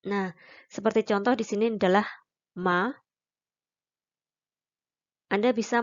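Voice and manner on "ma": "ma" with a slight upward glide; second tone. Shown as a demonstration. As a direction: let "ma" rise at the end. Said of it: "naik sedikit".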